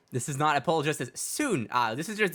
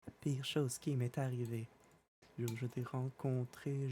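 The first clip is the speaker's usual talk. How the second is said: dramatic recitation; 10 dB quieter than usual. Acted. Quiet dramatic French recitation.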